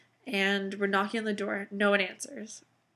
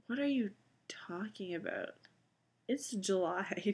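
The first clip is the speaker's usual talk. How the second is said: breathy, unvoiced whisper of confusion; whispered disbelief; 7 dB quieter than usual. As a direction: whisper it like a private thought. Private thought at odd costume.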